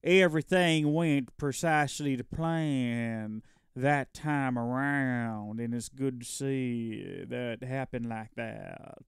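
slow, drawn-out self-satisfied narrator drawl; mock-pompous slow commentary. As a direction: stretch every word out slowly and pompously. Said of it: "I don't know why I'm talking like this".

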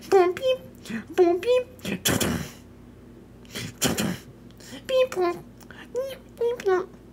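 very high beeping toy robot; chirpy high robot beeps. Acted. Imitating toy robot noises.

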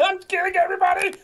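loud high excited yell; gleeful shout. Real, not acted. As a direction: crow it out loud.

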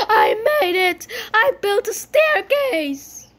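high, loud celebration; triumphant cheer. Real, not acted. Player celebrating a finished staircase, loud triumphant cheer. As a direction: shout it out in triumph, stretching the end.

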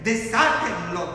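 ordinary delivery

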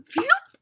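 high cartoon whoosh; silly spill sound. Sound effect in story.

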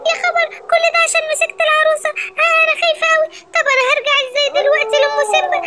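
very high-pitched frightened squeal; panicked. Acted. Lioness suddenly transformed by the doll, panicked.

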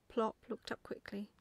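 ordinary delivery, nothing marked

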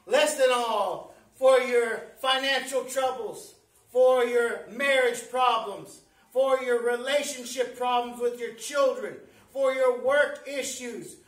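loud, driving list with weighty pauses; full-force preaching. Real, not acted. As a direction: hammer each item home to the congregation.

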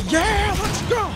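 high, slow, stretched-out cheer; squeaky hype cheer. Real, not acted. Hyped after a jump.

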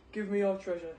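loud demand from the big robber; shouting robber. Acted. Robber demanding the monk's treasure, loud shout. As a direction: bark it out as a threat. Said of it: "he shouted".

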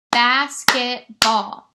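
"Basketball" loud and slow in three beats, BAS, KET, BALL; split into syllables. Shown as a demonstration. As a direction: clap out "Basketball" slowly, syllable by syllable. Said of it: "try it with clapping".

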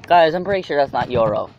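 normal talking voice

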